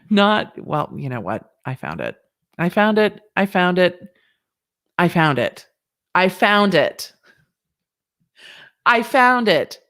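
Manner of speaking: ordinary talk, nothing marked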